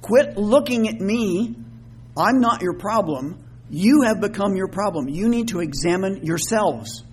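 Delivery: exasperated, each word driven home slowly; frustrated Paul. Acted. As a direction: scold them firmly, spacing out the words. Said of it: "how frustrated Paul was with this".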